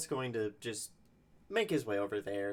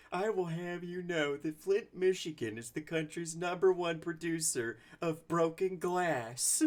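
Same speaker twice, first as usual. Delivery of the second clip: put-on offended boast, pitched up; mock indignation; 7 semitones higher, 3 dB louder than usual. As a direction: defend it with hammed-up local pride. Mock indignant defence of Flint, acted.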